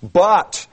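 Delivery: "But" punched high and loud; emphatic but. Shown as a demonstration. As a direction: hit "But" hard as a sharp contrast. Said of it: "that is a strong adversative".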